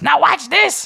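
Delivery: very high, squeaky hustler voice; Danny Deep Pockets. Acted. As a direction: play a slick, grinning money changer. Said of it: "And he says".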